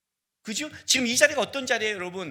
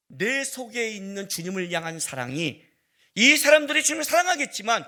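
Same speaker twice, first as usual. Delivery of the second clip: ordinary talk, nothing marked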